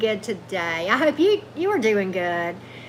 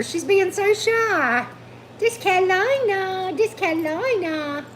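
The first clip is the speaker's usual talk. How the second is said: cooing over the dog; 10 semitones higher than usual. Real, not acted. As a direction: gush over a shy pet.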